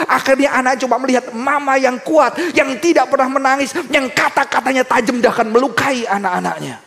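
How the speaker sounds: loud, high sermon delivery; full-force preaching. Acted. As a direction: preach it with fire.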